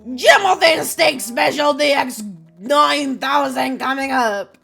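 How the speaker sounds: put-on slow, grand French-accented announcement. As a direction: announce the dish as a French bartender. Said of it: "Aggressively French".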